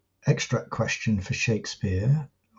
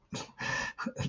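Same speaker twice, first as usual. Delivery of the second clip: quick breathy chuckle; short laugh; 15 dB quieter than usual. Real, not acted. Teacher amused at his own stumbling aside, short chuckle. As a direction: chuckle to yourself mid-sentence.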